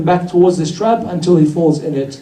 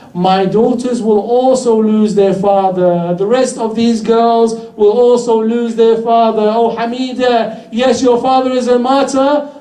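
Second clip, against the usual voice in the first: preaching lament; 4 semitones higher than usual. Acted. Imam Hussein foretelling Karbala to the orphan Hamida, mournful lament. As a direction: pour grief into each "you will see".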